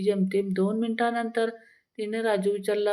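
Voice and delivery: normal talking voice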